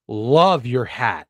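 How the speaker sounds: slow, drawn-out admiring compliment. Real, not acted. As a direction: stretch it out, admiring. Admiring co-host's hat.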